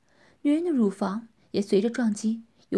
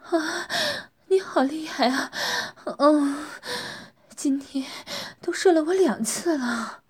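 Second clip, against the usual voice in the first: higher moaning praise; 5 semitones higher, slower than usual. Acted. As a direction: purr out the praise. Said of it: "女人呻吟着说". (呻吟着夸他)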